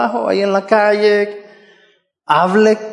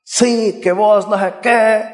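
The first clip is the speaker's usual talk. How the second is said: mock rude husband; slower than usual. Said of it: "si yo empiezo a decirle algo feo".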